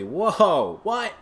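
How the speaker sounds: high, loud pretend astonishment; hammed-up surprise; mock amazement. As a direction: play up the shock, pitch jumping up on the last word. Mock amazement at own announcement.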